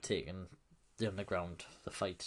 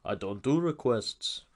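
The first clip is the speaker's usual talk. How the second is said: slow, emphatic deadpan; hammed-up Arnold deadpan; Schwarzenegger one-liner; 2 semitones higher, 6 dB louder, slower than usual. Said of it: "Schwarzenegger again with a great line".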